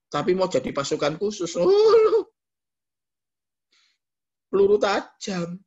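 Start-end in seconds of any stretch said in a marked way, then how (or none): 1.6-5.6 high, loud gasping oh; hammed-up awe at live rounds; dramatic exclaim. Dramatized army training. (seruan dramatis, tinggi dan keras)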